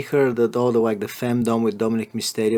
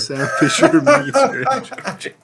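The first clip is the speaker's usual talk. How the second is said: laughing through the words, higher; 13 semitones higher, 6 dB louder than usual. Real, not acted. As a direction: crack up mid-sentence. Laughing repeat of the joke.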